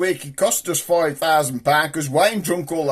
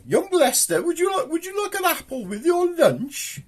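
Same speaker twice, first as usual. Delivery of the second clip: much higher, prissy parent voice; hammed-up proper mum to young Lester; mock posh parent; 14 semitones higher than usual. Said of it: "these new parents".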